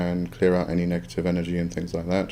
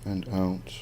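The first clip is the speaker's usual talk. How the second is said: soft spa whisper; 6 dB quieter than usual. Acted. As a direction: guide the breathing gently, unhurried. Leads breathing meditation.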